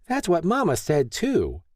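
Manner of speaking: put-on high, chirping little girl; chirpy child. A narrator's reading. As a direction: chirp it like a happy toddler. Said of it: "Noelle chirped".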